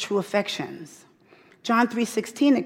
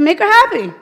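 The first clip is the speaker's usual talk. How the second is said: much higher and louder fatherly order; husband's bold command; 10 semitones higher, 11 dB louder than usual. Retold or imitated. Husband telling the tattling daughter, loud and high. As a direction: boom it out like a dad settling kids. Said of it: "He said".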